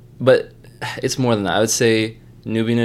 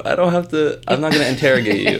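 laughing; 6 semitones higher, 5 dB louder, faster than usual. Real, not acted. Laughs while reassuring host.

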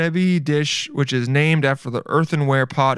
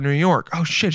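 ordinary delivery

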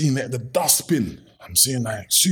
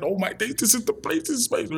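higher, thrilled; excited; 11 semitones higher, faster than usual. Retold or imitated. To his partner seeing the jumpers, thrilled and higher. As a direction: gush like you struck gold.

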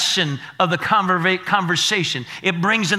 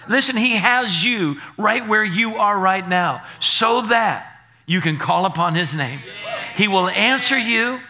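usual speaking voice

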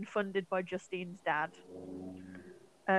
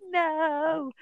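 mock anguished no; 8 semitones higher, 4 dB louder than usual. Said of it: "Alejandro's like".

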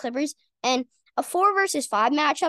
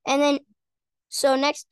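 ordinary delivery

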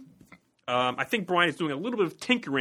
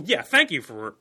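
normal talking voice

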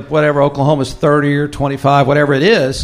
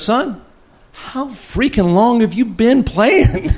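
higher-pitched, drawn-out mock disbelief; exasperated coach; 6 semitones higher, slower than usual. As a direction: imitate an incredulous coach, stretching out the question. Imitating coach's exasperated question to player.

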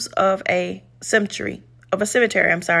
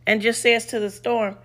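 ordinary talk

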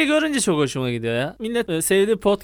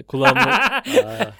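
put-on old man voice; 3 semitones higher, much slower than usual. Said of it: "Şimdi girdim bak".

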